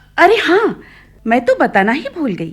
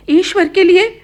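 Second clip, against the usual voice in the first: pleading cry; 9 semitones higher, 6 dB louder than usual. Acted. Mother pleading with daughter.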